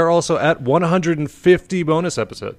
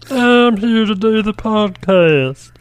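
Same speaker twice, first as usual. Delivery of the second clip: even higher, slow, strained voice; weirder put-on voice; 7 semitones higher, slower than usual. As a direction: push the strange voice further and higher. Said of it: "make it weirder and weirder".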